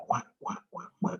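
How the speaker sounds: soft imitated duck quacks; duck quacking. Shown as a demonstration. Teacher explains to the class what a duck is, imitated quacking. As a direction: quack like a duck in short, quiet bursts. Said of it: "what is a duck?".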